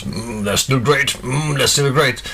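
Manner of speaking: slow, savouring hum into a catchphrase; lightly voiced mascot taste test; hum, then draw out the approval slowly; tiger tasting cereal. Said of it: "he tries every fifty thousandth one".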